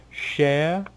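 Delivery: high, flirtatious lilt; flirty Cher. Acted. Cher leans in to Maya, flirty. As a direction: bat your eyes on the name. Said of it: "leans in, eyes batting".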